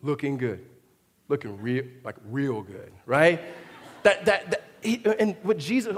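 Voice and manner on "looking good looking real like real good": lightly voiced self-admiring drop in pitch; a hint of vain self-approval, low; smug pride. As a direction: admire yourself in the mirror, low and pleased. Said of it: "pride looks in the mirror and goes".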